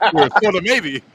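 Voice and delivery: laughing mock insult. Quote laughed out.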